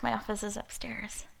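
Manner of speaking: breathy aside in a whisper; hushed, as if sneaking. Real, not acted.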